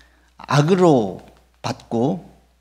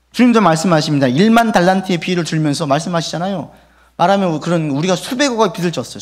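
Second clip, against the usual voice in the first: louder, high, driving sermon voice; full-force preaching; 5 semitones higher, 6 dB louder than usual. Acted. Preacher rousing the congregation on forgiveness, loud preaching.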